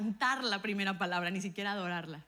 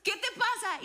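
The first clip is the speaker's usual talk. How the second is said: parents alarmed; 12 semitones higher, 5 dB louder than usual. Retold or imitated. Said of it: "Mis papás salieron corriendo".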